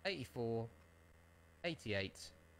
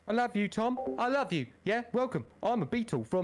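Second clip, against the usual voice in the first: put-on old-time English accent; 9 semitones higher than usual. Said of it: "this is how they used to speak".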